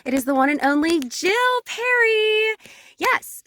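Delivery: loud, high-pitched announcer hype; triumphant show-host reveal; big guest introduction. Real, not acted. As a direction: build to a ringing, high shout on the name.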